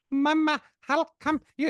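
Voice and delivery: put-on high, loud child with an Indian accent. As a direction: imitate a kid in an Indian accent. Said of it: "doing this Indian accent".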